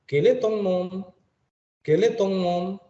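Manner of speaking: "Quel est ton nom?" high, rising at the end; rising question. Shown as a demonstration. Teacher models the informal question, high and rising. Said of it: "kita bisa gunakan".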